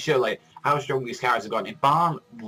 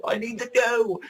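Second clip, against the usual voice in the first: much higher, pleading surprise; surprised demand; 11 semitones higher than usual. Retold or imitated. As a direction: demand the answer in disbelief. Reader's shock at the chapter.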